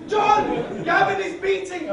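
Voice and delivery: loud, high, drawn-out yell; frantic yelling. Acted. Linda shouting across the road to John.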